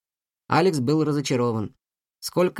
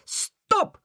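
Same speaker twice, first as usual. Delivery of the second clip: shouting. A narrator's reading. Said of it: "крикнул Алекс, пожалуй, слишком громко".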